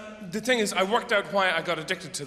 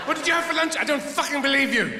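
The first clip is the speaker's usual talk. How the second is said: mocking shout; 8 semitones higher than usual. Comic mocking a believer in the crowd: scornful shout. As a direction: jeer at the believer, full voice.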